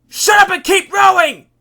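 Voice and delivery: high, furious full-volume yell; shouted order. A narrator's reading. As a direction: silence the panicker with a yell. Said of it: "Giff shouted".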